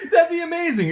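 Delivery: delighted laugh through the line. Real, not acted. Cohost laughing at the horse race wedding idea, delighted.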